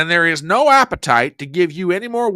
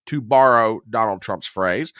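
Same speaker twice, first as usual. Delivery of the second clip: usual speaking voice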